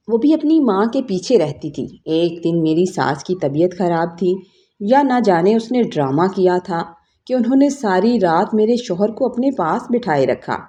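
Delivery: ordinary delivery, nothing marked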